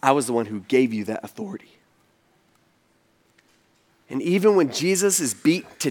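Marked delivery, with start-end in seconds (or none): 0.0-1.8 low, soft, unruffled answer; softly spoken, lowered retort; calm reply. Retold or imitated. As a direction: answer quietly, dropping right down. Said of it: "Jesus looks at him in his eyes and he says".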